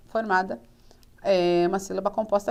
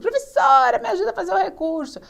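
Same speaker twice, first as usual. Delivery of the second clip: high, quick imitated plea to teacher; mock plea, pitched well up; pleading student; 7 semitones higher than usual. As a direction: mimic a student begging for help. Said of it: "vem aquelas pessoas que querem elaboração de recurso".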